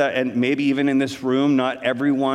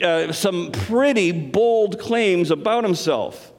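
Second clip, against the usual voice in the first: ordinary delivery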